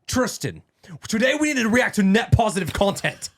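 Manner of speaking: high, loud mock Andrew Tate accent; Andrew Tate impression. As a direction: ham up Tate's voice to his brother. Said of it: "Why was that impersonation so good?".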